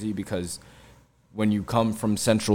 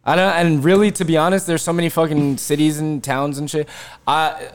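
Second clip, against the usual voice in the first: higher and louder, fired up; raised, exasperated rant; worked-up rant; 7 semitones higher, 5 dB louder than usual. Real, not acted. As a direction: defend your home with heat. Worked up defending Central Jersey.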